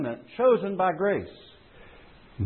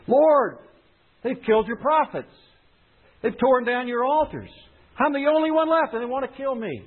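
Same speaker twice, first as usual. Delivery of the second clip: Elijah pleading; 9 semitones higher than usual. Acted. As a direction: complain to God as the hunted prophet. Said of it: "he thinks back to Elijah".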